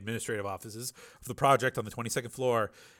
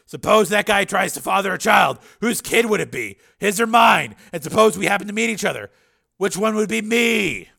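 raised, higher, pressing argument; Steele, agitated; 10 semitones higher, 12 dB louder than usual. A narrator's reading. Patient imagines his mind in another body, loud and agitated. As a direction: build to a loud indignant question.

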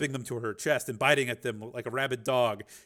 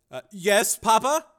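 higher, timid reply; a son jumping to attention; nervous son; 8 semitones higher, 7 dB louder, slower than usual. Acted. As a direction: son answering his mobster father. Son answers father.

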